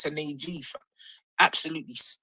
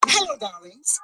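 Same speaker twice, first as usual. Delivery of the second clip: campy drag queen; 8 semitones higher than usual. Acted. Camp greeting from a new speaker.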